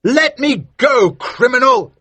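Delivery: high, loud outraged shout; shouting Maskew. A narrator's reading. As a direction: yell it down at the smuggler in a high voice. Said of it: "shouted Maskew".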